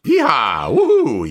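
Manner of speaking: whooping cowboy cheer; yeehaw. Real, not acted. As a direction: holler it like a rodeo. Cheering at show start.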